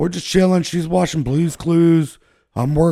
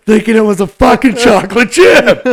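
louder, cracking up as he yells it; laughing shout; 6 semitones higher, 7 dB louder than usual. Real, not acted. Punchline to podcast friends, laughing shout. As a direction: yell the punchline through laughter.

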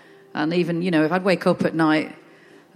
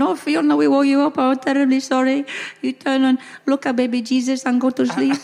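Spanish mum; 6 semitones higher than usual. Retold or imitated. Spanish mum soothing her woken daughter in a lilting accent. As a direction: coo it like a fussing Spanish mother. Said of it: "my mum went".

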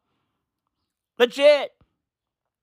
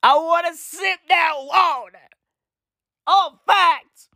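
comic whine; 6 semitones higher than usual. Pretend. Comic craving for old water.

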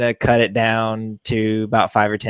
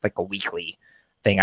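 normal talking voice

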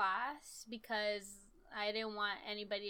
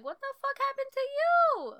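very high, loud screech; mock-horrified high outburst; imitated shocked teacher; 10 dB louder than usual. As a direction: shriek it as a hammed-up appalled teacher.

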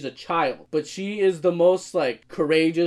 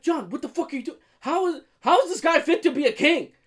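much higher mocking rant voice; high incredulous mock rage with a laugh; ranting at the show; 12 semitones higher than usual. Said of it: "And I'm just like".

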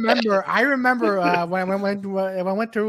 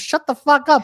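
higher-pitched mock-angry outburst; annoyed retort; 6 semitones higher, 4 dB louder than usual. Pestered friend to heckling comic, mock angry. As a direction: act out an exasperated friend.

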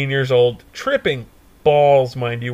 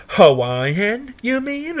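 higher, questioning woman's voice; imitated pizza clerk; 8 semitones higher, much slower than usual. Pizza lady correcting the caller's order, high questioning voice. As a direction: lift it high into a puzzled query. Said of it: "she goes".

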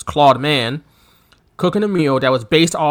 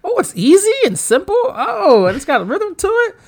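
wandering pitch; 13 semitones higher than usual. Real, not acted. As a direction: voice bouncing higher while dancing. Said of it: "your voice is fluctuating".

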